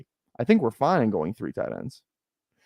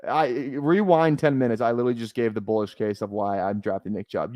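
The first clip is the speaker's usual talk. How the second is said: normal talking voice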